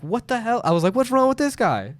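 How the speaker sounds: a rising, disbelieving what the hell; exasperated disbelief. Retold or imitated. The narrator reacting to the silent waiter, incredulous.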